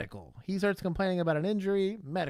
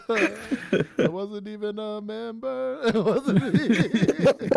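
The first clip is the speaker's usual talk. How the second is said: laughing; 5 semitones higher, 4 dB louder, much slower than usual. Retold or imitated. Quoting suspect while cracking up.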